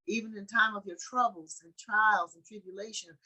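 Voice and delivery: ordinary delivery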